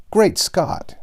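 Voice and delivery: a loud, high burst of exasperation; distressed exclamation. Acted. Hank interrupts Sandy's monotonous tale, exasperated outcry. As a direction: cry out in dismay at her droning. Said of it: "I said in my distress".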